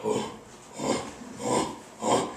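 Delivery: breathy panting ooh chimp sounds; rumbling pant. Shown as a demonstration. Lecturer demonstrating the pant part of a pant hoot, panting oohs. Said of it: "it's a rumbling growl".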